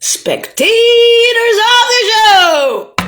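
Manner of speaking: high, slow, loud showman cry; announcer call. Acted. Calling out to the spectators, a drawn-out showman cry. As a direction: bellow it to the crowd.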